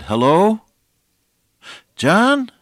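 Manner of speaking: amazed hello. Retold or imitated. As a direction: call his name in sudden wonder. Widow hears her husband's voice.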